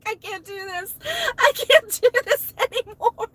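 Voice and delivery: high, breaking, desperate plea; near tears. Retold or imitated. A frazzled substitute at her limit, desperate tearful plea. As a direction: beg as if you're about to crack. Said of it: "I was like".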